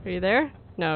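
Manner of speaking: normal talking voice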